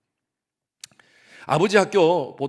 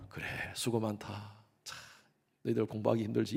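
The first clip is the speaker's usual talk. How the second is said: kind father; 5 semitones lower, 9 dB quieter than usual. Retold or imitated. Father softly encouraging his children at dinner. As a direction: comfort the tired kids gently. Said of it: "이렇게 얘기하지 않고".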